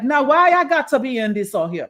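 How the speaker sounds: put-on light, hammy island accent; Caribbean accent. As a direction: toss it off in a bad Caribbean accent. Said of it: "that's a terrible Jamaican accent".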